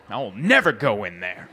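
low mock snarl, holding a grudge. Comic driving past an old venue, low mock snarl. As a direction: growl it through your teeth at the building. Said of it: "snarl at the venue going".

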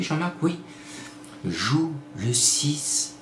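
voix intérieure soufflée, très douce et lente; petite voix intérieure. Pretend. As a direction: souffle le conseil comme une voix dans la tête. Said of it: "une petite voix qui m'a dit". (petite voix qui murmure de jouer le six)

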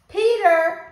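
calling out. Acted. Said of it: "my father called".

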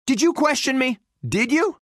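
high, rising taunting questions; cocky challenge. Acted. Winner to those who questioned him, cocky challenge. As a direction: needle the doubters after winning.

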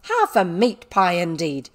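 loud, indignant schoolmistress; acted angry telling-off; angry scolding. A narrator's reading. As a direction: accuse with scornful outrage. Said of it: "said Miss Minchin's voice".

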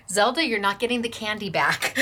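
ordinary talk, nothing marked

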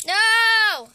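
high, loud cry of dismay; sudden loud no. Real, not acted. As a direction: yelp as everything spills. Collection falls out.

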